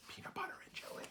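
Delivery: soft secret whisper; whispered explanation; whispered aside. Acted. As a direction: let them in on it quietly. Aside after rhyme.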